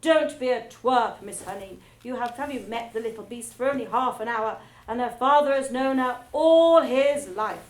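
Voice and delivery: loud, fast, contemptuous; scornful scolding. Acted. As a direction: scold her like a fool. Trunchbull scolds Miss Honey.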